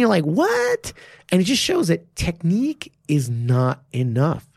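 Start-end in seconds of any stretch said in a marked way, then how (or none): 0.2-0.9 high-pitched, incredulous "what"; baffled disbelief. Acted. Baffled viewer reacting to the fighter getting dropped, incredulous. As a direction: let it leap up in mock disbelief.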